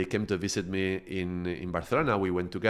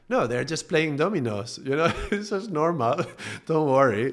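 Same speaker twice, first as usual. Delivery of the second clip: higher, amused reassurance through chuckles; laughing reassurance; 7 semitones higher, 4 dB louder than usual. Retold or imitated. Calming a friend about the domino game, amused and laughing. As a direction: brush off the worry with a laugh.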